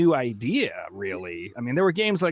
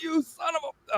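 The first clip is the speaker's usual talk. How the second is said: upset; 6 dB quieter than usual. Retold or imitated. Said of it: "so upset".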